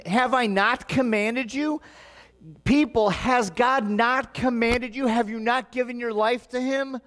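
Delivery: fervent pulpit delivery; preaching. Acted. Pastor preaching to congregation.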